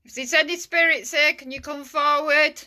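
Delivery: loud, high call to spirits. Real, not acted. Ghost hunter asking spirits to come forward, calling out. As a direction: project it to unseen listeners.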